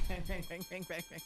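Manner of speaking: laughing bang-bang. Retold or imitated. Mocking Helga banging her pot, laughing. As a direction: giggle out the clangs of a banged pot.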